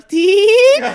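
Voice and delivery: squeaky high beep; high beep noise. Retold or imitated. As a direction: mimic a monitor beep, very high and loud.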